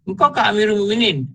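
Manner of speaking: high-pitched retold shock; startled. Envoy realises the man is the caliph, startled. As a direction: ask in astonished disbelief, voice lifted. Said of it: "Terkejut lelaki ni".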